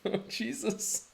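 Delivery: high, amused "Oh, Jesus"; amused exasperation. Real, not acted. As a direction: groan it out while laughing. Laughing at chat.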